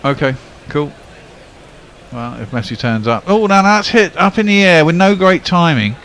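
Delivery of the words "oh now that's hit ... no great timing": excited commentary. Real, not acted. Live commentary on a lofted shot.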